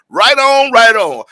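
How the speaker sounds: high, loud "right on"; whooping right on; excited cheer. Real, not acted. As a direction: celebrate the Mississippi winners. Excited cheer for Grammy win.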